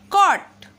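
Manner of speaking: कॉट said with a short open aw; model word cot. Shown as a demonstration. Teacher models कॉट for pupils, open aw vowel. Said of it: "C O T को कॉट पढ़ेंगे".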